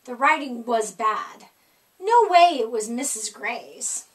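usual speaking voice

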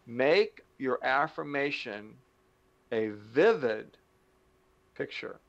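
slow, deliberate teaching pace; said slowly. Shown as a demonstration. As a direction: spell it out word by word so no one misses it. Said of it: "I'll say it slowly so you don't miss it".

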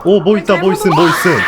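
startled, loud and quick; excited shout. Real, not acted. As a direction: yell out the discovery. Spots a monster.

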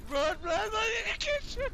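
panicked loud high yelling; being chased. Real, not acted. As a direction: scream for your life while fleeing. Fleeing the boss in game.

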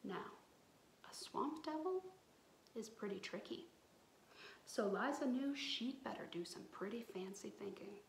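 fast hushed storyteller whisper; storyteller whispering about the devil; spooky whisper. A narrator's reading. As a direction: whisper it like a scary secret. Whispered narration.

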